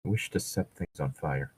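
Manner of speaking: a quiet, joking dark wish; mock muttering. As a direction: mutter it darkly, half joking.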